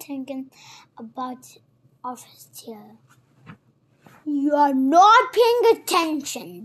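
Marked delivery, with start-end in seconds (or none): 4.3-6.6 loud, cross scolding; snapping. A narrator's reading. The mouse catches Alice not listening, snapping at her. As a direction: snap it out loudly. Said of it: "the mouse snapped".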